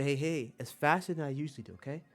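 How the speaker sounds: ordinary talk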